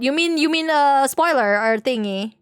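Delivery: ordinary talk, nothing marked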